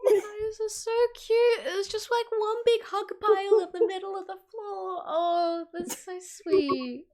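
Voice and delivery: melting with delight, voice way up high; gushing. Real, not acted. Gushing over players' group hug.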